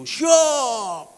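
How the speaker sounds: loud, high, drawn-out "yes sir" shout; military-style shouted acknowledgement; guard's shouted salute. Retold or imitated. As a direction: shout it like a doorman to the boss. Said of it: "bagian penjaga pintu".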